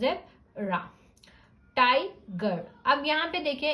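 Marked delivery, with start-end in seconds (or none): 0.0-0.8 "zebra" split as zeb-ra; two syllables. Shown as a demonstration. Teacher showing two syllables, "zebra" broken into zeb and ra. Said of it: "it will be two syllable word". 1.8-2.7 tiger split as tai-gar; syllable break. Shown as a demonstration. Teacher showing where the break falls, tiger in two chunks. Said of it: "इन दोनों word में".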